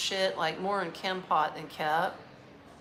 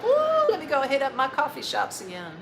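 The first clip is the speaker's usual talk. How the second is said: higher, louder happy cheer; excited whoop; 7 semitones higher, 6 dB louder than usual. Retold or imitated. Host tells viewers how glad he was to be back, excited. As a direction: back in the city and thrilled about coffee.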